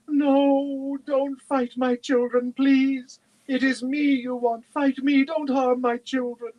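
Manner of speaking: very high, loud, pleading wail; heartbroken shrieking. A narrator's reading. Mother dragon begging for her children, shrieking heartbroken. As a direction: beg at full pitch as an anguished mother. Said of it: "shrieked Matafleur in a heartbroken voice".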